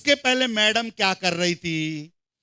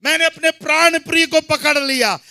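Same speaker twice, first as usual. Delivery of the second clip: forceful preaching; 7 semitones higher, 7 dB louder than usual. Real, not acted. Preacher echoes the verse to the congregation, forceful preaching. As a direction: proclaim each phrase to the whole hall.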